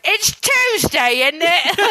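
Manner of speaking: high, drawn-out British catchphrase; British accent. Shown as a demonstration. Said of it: "I just need to think back to".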